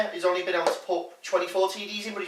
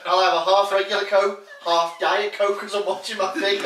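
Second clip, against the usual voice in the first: laughing through a silly order; giggly mock food order; 5 semitones higher, 7 dB louder than usual.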